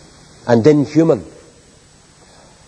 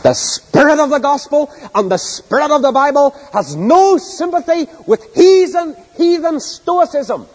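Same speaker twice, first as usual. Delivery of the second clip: soaring high, slow on heathen; preaching at full force; 13 semitones higher than usual. Acted. Preacher denouncing heathen stoicism to the church, soaring. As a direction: build to a ringing peak.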